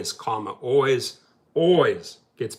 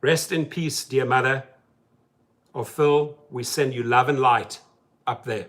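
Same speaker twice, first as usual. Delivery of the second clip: ordinary talk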